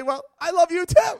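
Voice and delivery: high, loud, bewildered reply; hammed-up stunned politeness; mock dazed reply. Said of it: "half state of shock".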